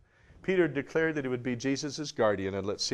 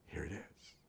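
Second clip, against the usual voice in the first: soft whisper, almost no voice; whispered "Here it is" barely audible; whispered reminder; 15 dB quieter than usual. Acted. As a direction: breathe it like a secret. The Spirit quietly reminds Peter.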